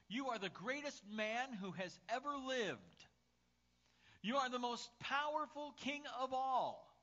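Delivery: high, slow, grandly declaimed flattery; theatrical adoration, drawn out; fawning courtier. Acted. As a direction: proclaim lavish praise to a monarch. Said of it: "would flatter him all the time".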